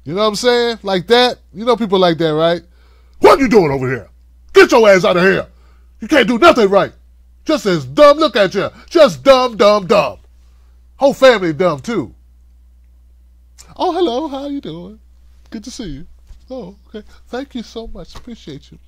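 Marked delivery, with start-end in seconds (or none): 3.2-12.1 loud, harsh berating boss; angry boss. Acted. Boss berating staff again. 13.7-18.8 high, soft, sugary greeting; sweet public voice. Acted. Sweet public face again.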